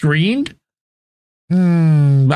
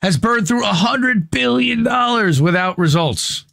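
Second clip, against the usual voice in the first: put-on Southern accent; 5 semitones higher than usual. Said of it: "I don't know why I said that in Southern accent".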